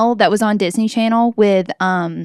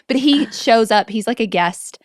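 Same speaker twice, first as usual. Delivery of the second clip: normal talking voice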